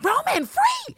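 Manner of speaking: very high, wailing mock plea; mock outcry. Recapper protesting who gets locked up, high mock outcry. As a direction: cry it out in a squeaky falsetto.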